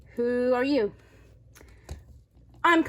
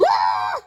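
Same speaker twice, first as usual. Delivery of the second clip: sudden scream of fright; startled scream at the neighbor; 4 dB louder than usual. Acted. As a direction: shriek at the sight of her. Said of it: "you gotta scream your head off".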